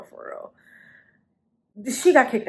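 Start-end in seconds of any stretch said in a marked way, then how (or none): 0.0-0.5 whispered echo. Retold or imitated. Whispered repeat of phrase.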